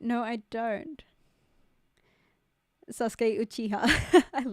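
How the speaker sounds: normal talking voice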